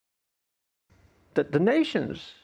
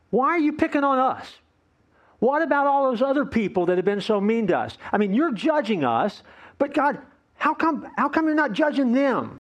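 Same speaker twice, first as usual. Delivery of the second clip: higher-pitched, mock protest to God; aggrieved complaint; 6 semitones higher than usual. People complaining to God that He judges only them, aggrieved. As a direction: act out a people who feel picked on.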